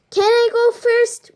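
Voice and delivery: high eager shout; kids yelling. A narrator's reading. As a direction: call out eagerly to go first. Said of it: "we yelled".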